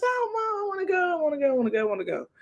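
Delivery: high, begging repeated plea; pleading daughter. Retold or imitated. Daughter pleading with mom to go, high and begging. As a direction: beg like a kid who wants it badly. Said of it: "And she goes".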